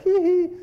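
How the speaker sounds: very high squeaky mock giggle; mock girly giggle. Imitating girls reacting to a dancing guru, high mock giggle. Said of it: "girls like".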